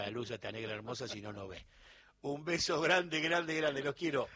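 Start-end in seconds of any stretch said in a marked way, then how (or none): 2.2-4.3 louder, higher goodbye; loud farewell. Real, not acted. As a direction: send big kisses to the audience. Host farewell to listeners.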